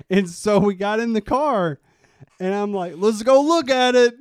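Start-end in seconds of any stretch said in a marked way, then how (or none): 3.0-4.1 hammed-up tipsy shout, high and loud; drunken enthusiasm. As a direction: holler it like an eager drunk. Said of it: "I was drinking and I was on Craigslist".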